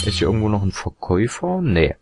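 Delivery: ordinary delivery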